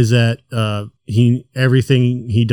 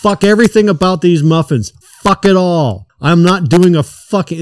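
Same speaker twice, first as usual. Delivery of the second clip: higher, louder mock managerial tirade; imitated pissed-off rant; 7 semitones higher, 4 dB louder than usual. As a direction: act out a boss losing patience. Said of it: "he's just, like, pissed".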